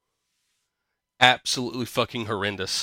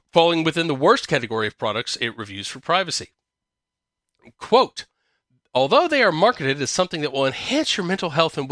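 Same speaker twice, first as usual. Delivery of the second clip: higher-pitched, animated news read; article read-aloud; 3 semitones higher than usual. Acted. Host reads AI girlfriend article to co-host, article read-aloud.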